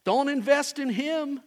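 mock broker's scoff; scornful dismissal. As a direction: brush him off like a bad investment. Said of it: "We looked at him and said".

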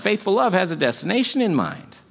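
ordinary delivery

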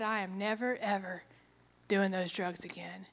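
low, soft, resolute vow; quiet firm refusal. Retold or imitated. Hostage refusing drugs from her captor, quietly resolute. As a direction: refuse quietly, spacing the words out.

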